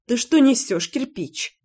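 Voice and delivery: understated indignant question. A narrator's reading. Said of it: "возмутилась я".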